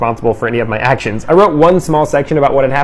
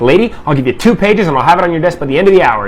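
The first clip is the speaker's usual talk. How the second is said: higher, sassy comeback; 4 semitones higher, 3 dB louder than usual. Retold or imitated. Student talking back to the vice principal, sassy. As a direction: talk back with cheeky defiance. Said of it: "I was feeling sassy".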